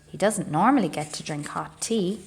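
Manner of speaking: ordinary delivery, nothing marked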